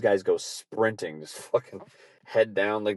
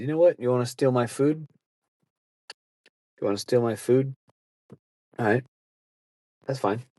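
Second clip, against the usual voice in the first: fed-up resolve; 4 semitones higher than usual. Retold or imitated. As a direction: throw it down like a dare. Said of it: "You decided".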